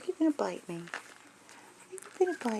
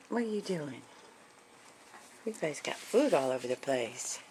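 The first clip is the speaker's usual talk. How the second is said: ordinary talk, nothing marked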